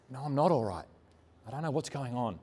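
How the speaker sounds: soft, subdued retelling of distress; retold sobbing. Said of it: "And I was just sobbing".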